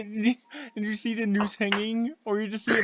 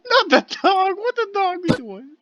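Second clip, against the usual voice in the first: laughing squeal; 11 semitones higher, 3 dB louder than usual. Real, not acted.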